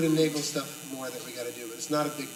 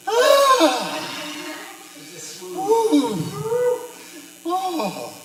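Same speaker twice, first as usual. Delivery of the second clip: high sighing exclamations; 10 dB louder, much slower than usual. Retold or imitated. Hears big sighs, imitates them.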